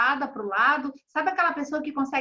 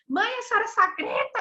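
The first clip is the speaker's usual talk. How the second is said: put-on much higher, whiny child complaint; imitating daughter; 8 semitones higher than usual. Daughter complaining to her mother, lightly mimicked high whine. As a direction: mimic the kid's protest. Daughter voiced in retold fight.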